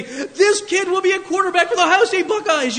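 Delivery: high, loud proud boast; new father crowing over his son; proud shout. Pretend. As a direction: hold the baby up and crow. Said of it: "hold him up and say".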